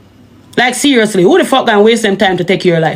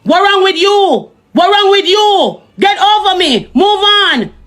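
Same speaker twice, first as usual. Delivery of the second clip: high pitched angry rant; 7 semitones higher than usual. Real, not acted. Ranting at the ex.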